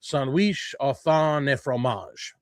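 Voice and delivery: put-on slowish, careful French accent; a playful French accent on the dish name. Host reads the menu item aloud, playful French accent. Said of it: "say it with a French accent".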